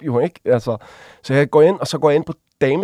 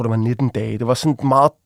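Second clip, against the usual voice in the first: normal talking voice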